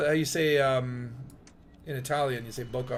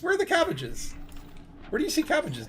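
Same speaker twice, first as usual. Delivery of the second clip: high-pitched comic voice; put-on high voice, hunting for cabbages; movie character impression; 12 semitones higher, 5 dB quieter than usual. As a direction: quote a film oddball in a much higher voice. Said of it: "and he just, like".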